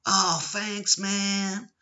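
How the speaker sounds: high, drawn-out mock American thanks; mock American tourist. Imitating a tourist thanking him for directions, mock American accent. Tourist's reply in acted exchange.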